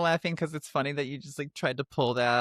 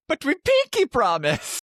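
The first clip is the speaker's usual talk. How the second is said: put-on Jamaican accent; 13 semitones higher, 5 dB louder than usual. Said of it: "That was a Jamaican accent".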